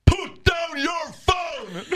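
high, slow, shouted police order; imitated shout.

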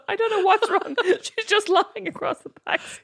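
high, laughing, baffled driver on the phone; laughing mock taxi driver. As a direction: giggle as a bewildered cabbie. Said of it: "taxi driver be calling the cops going".

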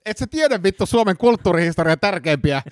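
high-pitched indignant suspect; epäilty, tuohtunut. Acted. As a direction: act scandalised at the interrogator's ignorance. Suspect in acted interrogation. (tuohtunut epäilty)

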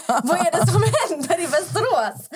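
high, incredulous laughing wail; laughing bewilderment. Retold or imitated. Friends baffled by the town, retold in laughing disbelief. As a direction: exclaim it in mock despair through laughter.

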